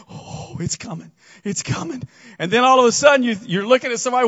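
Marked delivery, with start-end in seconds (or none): 0.1-2.0 whispered, softer, mock-tense; nervous whisper. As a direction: whisper it like the ride's about to drop. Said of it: "you're going".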